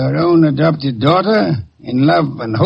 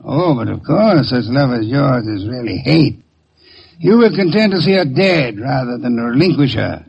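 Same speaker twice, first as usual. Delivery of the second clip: ordinary talk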